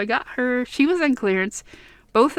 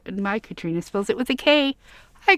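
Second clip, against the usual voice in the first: ordinary talk, nothing marked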